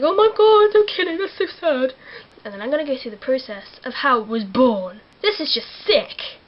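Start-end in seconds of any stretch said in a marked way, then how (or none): 0.0-1.9 high, loud, put-on wail; hammed-up shocked plea; mock distraught self. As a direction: squeal in over-the-top dismay. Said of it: "And I'm like".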